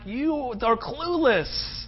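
exasperated leader brushing them off; a touch of Nehemiah's exasperation. Retold or imitated. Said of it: "Nehemiah's going like".

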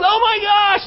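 sudden high, loud gasp of shock; a jailer jolted awake by the open doors; startled cry. Retold or imitated. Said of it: "And he goes".

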